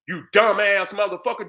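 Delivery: usual speaking voice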